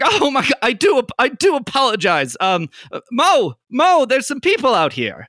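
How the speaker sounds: a scraggly elf flustered by the door accident; flustered apology. Acted. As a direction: gush apologies like a fussy professor.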